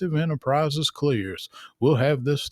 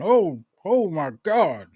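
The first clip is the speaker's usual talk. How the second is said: alarmed; 6 semitones higher, 3 dB louder than usual. Acted. Anchor sees something alarming.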